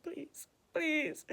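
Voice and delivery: soft, strained mock pleading; imitating a feeble plea through pain; pained begging. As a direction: beg weakly, about to collapse. Begs while falling from pain.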